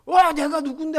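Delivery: loud, high, overbearing demand; domineering drunk. Retold or imitated. Drunk customer barking at the student helper, overbearing. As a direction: throw your weight around like a drunk boss. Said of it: "하면서 막 고압적으로".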